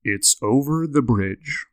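slow, deep, over-enunciated sleepy voice; lightly voiced sleepwalker giving directions; Pat's sleep-talking voice. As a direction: drone it out like a man talking in his sleep. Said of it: "he has a really distinct, deep voice and has very good diction".

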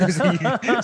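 laughing. Real, not acted. Laughs mid-sentence.

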